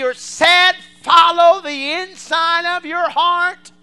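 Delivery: loud, high, slow pulpit cry; preaching at full force. Acted. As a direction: drive the point home from the pulpit.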